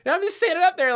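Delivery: high, fast, giddy rush; gleeful. Real, not acted. Marvelling at his toddler self on camera, giddy. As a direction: gush like you can't stop grinning.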